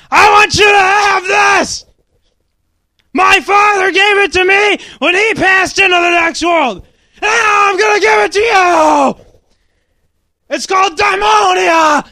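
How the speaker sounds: high, strained full-volume yell; wounded father. A narrator's reading. Voices dying father in story.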